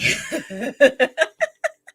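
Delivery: high, loud open laugh; real release laugh; burst of laughter. Real, not acted. Laughing after needing laughs.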